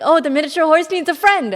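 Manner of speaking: high, loud playful justification; sing-song cheerful excuse; bright self-mocking enthusiasm; mock excited. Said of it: "I was like".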